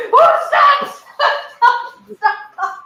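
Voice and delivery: very high, loud laughing cries of stop, fading; panicked begging. Retold or imitated. Begging the client's father to stop the cobra story. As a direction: beg him to stop, squealing then trailing off. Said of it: "I'm like".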